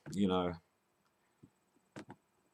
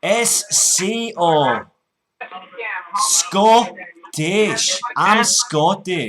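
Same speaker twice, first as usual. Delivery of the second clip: put-on loud, slow, high Scottish accent; put-on Scots, drawn out and loud; broad Scottish accent; 5 semitones higher, 5 dB louder, much slower than usual. Prank caller to scammer, broad Scottish.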